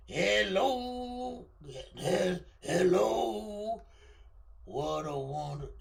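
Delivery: a voice unlike his own, a light [name] rasp; [name] impression. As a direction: greet like [name] crooning "Hello". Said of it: "Trying to do the [name] thing".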